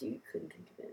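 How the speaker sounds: soft unvoiced whisper. Real, not acted. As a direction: drop into a whisper. Trailing whispered admission.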